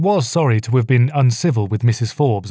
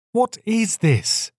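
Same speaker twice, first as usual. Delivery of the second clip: slow, high-pitched indignant demand; scowling demand; 11 semitones higher, much slower than usual. A narrator's reading. Joseph confronting the crowd, scowling and indignant. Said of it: "Joseph interrupted with a scowl".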